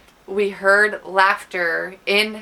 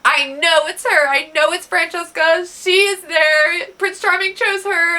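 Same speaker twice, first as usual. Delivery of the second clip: high, loud hammed-up lament; mock bawling; 8 semitones higher, 6 dB louder than usual. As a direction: wail each line like a heartbroken friend. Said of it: "Penelope is bawling".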